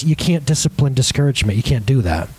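ordinary talk

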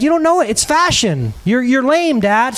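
mock teen daughter. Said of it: "she yelled at you".

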